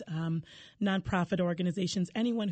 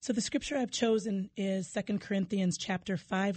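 normal talking voice

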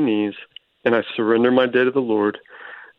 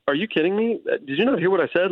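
mock disbelief, pitched up; higher, half-acted exasperation; incredulous retort; 7 semitones higher than usual. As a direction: replay your own annoyed comeback. Retells annoyed reply to sponsor.

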